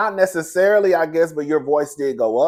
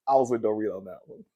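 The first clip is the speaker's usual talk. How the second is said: normal talking voice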